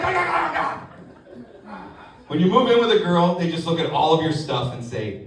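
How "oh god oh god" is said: pained groan. Retold or imitated. Pain while wife digs.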